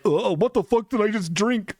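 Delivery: high, disgusted gag of a line; disgusted John. Pretend. John realizing what he just drank, disgusted. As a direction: voice John gagging on his drink. John's disgusted face, voiced.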